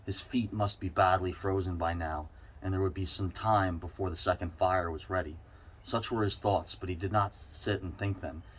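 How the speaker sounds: ordinary delivery, nothing marked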